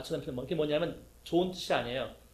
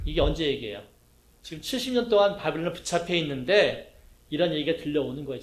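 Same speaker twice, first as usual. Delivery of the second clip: ordinary talk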